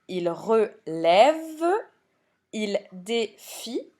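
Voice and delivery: "il relève, il défie" slowly split, re-lève, dé-fie; syllable by syllable. Shown as a demonstration. Teacher giving examples of the accent rule, "il relève, il défie" slow by syllable. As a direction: draw out and separate each syllable of "il relève, il défie". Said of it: "la dernière lettre de la syllabe".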